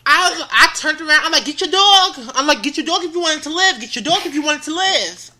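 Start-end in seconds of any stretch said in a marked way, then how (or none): 1.4-2.1 threatening yell. Retold or imitated. Threatening owner of chasing dog. 2.6-5.3 mock threat. Threatening owner of chasing dog.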